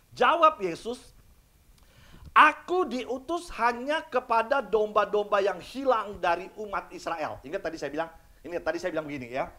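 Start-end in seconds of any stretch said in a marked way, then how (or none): 2.4-7.4 slow, weighty scripture reading; measured solemn scripture voice. Acted. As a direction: read Jesus' words slowly. Jesus' words read solemnly.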